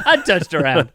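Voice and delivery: loud laughing mimicry; laughing mock echo. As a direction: tease the slip through laughter. Laughing, mocking his slip.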